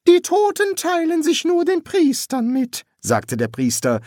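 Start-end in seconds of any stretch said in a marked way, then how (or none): 0.1-2.8 very high-pitched put-on priest voice. Priest relaying the ghost's greeting to the son, high put-on voice. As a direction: pitch the priest far above the narrator.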